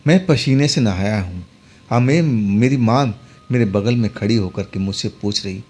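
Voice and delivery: usual speaking voice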